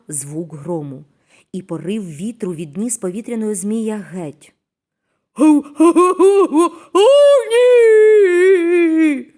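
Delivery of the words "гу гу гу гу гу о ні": high, loud yelping howl ending in a long wail; loud, high-pitched dramatic wail; despairing cry. A narrator's reading. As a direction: cry out in despair like a distressed puppy. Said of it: "У розпачі закричав Регі".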